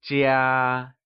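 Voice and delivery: "jia" held long on a level tone; high level tone. Shown as a demonstration. Teacher models the Mandarin word for home, "jia" in high level tone. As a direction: draw out "jia" without dropping the pitch. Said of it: "pronounced using the high level tone".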